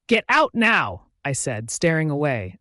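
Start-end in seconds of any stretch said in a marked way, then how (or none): 0.1-1.1 loud, high, drawn-out command; arrogant order. A narrator's reading. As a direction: order them out, slowly and forcefully. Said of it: "I was talking to him arrogantly".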